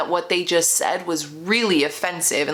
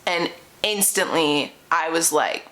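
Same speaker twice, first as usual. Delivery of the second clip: ordinary talk, nothing marked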